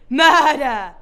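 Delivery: loud dramatic shout on murder. Acted. Detective arresting the owner, shouting murder. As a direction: yell the word like a verdict.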